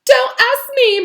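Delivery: high, loud, slow ghostly shout. Acted. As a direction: fling it back at him. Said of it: "Myrtle shouted".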